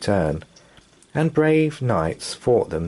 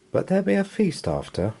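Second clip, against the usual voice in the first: put-on child voice; 11 semitones higher, faster than usual. Son's voice.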